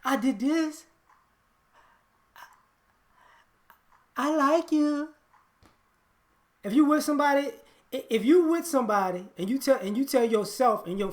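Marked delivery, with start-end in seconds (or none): 0.0-5.1 high-pitched mock-sweet voice; childish mimicry. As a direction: put on a little high voice, halting. Mimics someone's silly, childish show of love.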